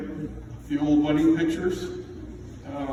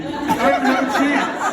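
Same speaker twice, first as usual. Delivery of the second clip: laughing; 10 semitones higher, 4 dB louder, slower than usual. Retold or imitated. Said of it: "my wife was so quick to say".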